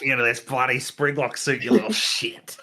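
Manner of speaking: hammed-up Australian accent, breaking into a laugh; [name]. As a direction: threaten in a mock Aussie voice. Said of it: "[name] be Australian".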